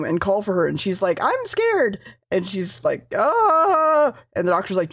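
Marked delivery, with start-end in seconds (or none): 1.2-2.0 high-pitched pretend fright; mock fear. Acting the frightened princess. 3.2-4.1 high, drawn-out pretend scream; mock scream. Acting the scared princess's cry.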